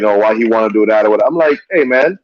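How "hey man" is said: ordinary talk, nothing marked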